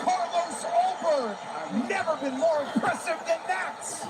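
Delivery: loud, high, slow announcer call; excited shout. Real, not acted. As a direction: shout the title shot. Commentator celebrates knockout.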